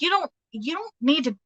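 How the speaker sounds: quick, emphatic talking-to for someone else; channeled objection. Acted. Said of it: "I feel somebody being like".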